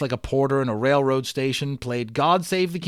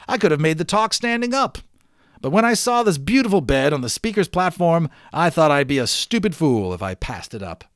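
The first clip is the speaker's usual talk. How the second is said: louder, higher, proud showman voice; boastful professor; 4 semitones higher, 6 dB louder than usual. Acted. Professor bragging to the cheering crowd, loud and proud. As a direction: crow it to the whole crowd.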